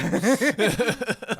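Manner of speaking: loud cackle; loud high laugh. Real, not acted. Laughing at own Mexican punchline, loud.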